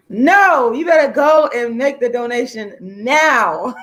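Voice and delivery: playfully bossy, stretching the words out slowly; mock-stern, sing-out insistence; playful demand. Playfully ordering viewers to donate.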